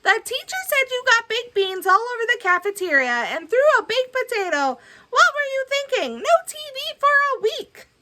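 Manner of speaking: high, fast lecturing mother; scolding mom. Acted. Mom lectures Billy walking home from school, high scolding. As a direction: nag him all the way home. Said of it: "Billy's mom lectured him".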